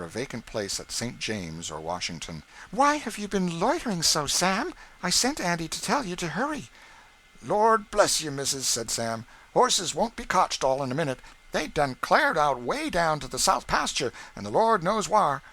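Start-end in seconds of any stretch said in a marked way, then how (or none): 2.7-6.7 higher, louder impatient lady; a lady's sharp reproach; mistress scolding. A narrator's reading. Mrs. Shelby scolding Sam. 7.4-15.4 higher, louder eager servant; loud, breathless excuses; Sam excusing. A narrator's reading.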